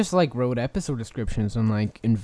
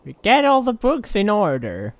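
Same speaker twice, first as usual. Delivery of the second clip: very high, loud bird screech; shrill librarian bird; 9 semitones higher, 3 dB louder than usual. Acted. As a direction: screech like a pterodactyl. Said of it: "be, uh, Miss Caw".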